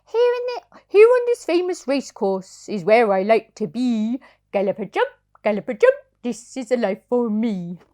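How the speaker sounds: a contented toy horse singing his joy; rocking horse voice. Acted. Parent reading to child as happy rocking horse. Sugar Lump speaks.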